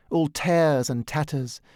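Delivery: ordinary delivery, nothing marked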